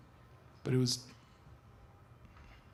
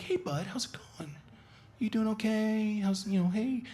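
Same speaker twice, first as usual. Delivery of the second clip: quiet, inviting dog voice; 7 semitones higher than usual. Shown as a demonstration. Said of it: "really, really quiet and, like, inviting".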